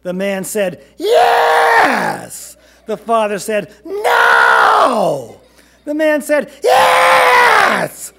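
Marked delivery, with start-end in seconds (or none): 1.0-2.6 very high, loud, stretched screech; insistent shout. A narrator's reading. Cashier argues back. 3.8-5.4 high, loud, drawn-out refusal; defiant shout. A narrator's reading. Father argues with cashier. 6.6-8.1 very high, loud, stretched screech; insistent shout. A narrator's reading. Cashier argues back.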